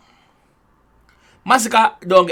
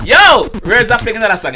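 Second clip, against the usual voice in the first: loud, higher burst of excitement; excited shout; 5 semitones higher, 8 dB louder than usual. Real, not acted. Reacting to a line in the song, excited shout. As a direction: shout out your reaction to the lyric.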